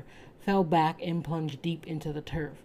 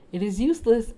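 put-on higher and louder girl's voice; Anne; 6 semitones higher, 6 dB louder than usual. A narrator's reading. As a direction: voice the girl, insistent. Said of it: "answered Anne".

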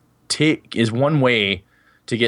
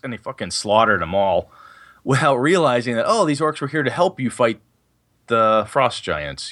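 ordinary talk, nothing marked

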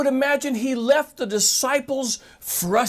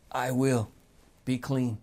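Jesus speaking gently; 10 semitones lower, 6 dB quieter than usual. Retold or imitated. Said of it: "And Jesus said this".

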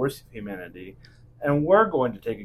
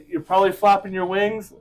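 ordinary delivery

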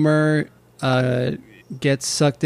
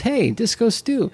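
higher-pitched call to someone going by; passer-by calling out; 8 semitones higher than usual. Retold or imitated. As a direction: hail someone passing. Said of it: "somebody says".

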